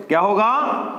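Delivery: ordinary talk, nothing marked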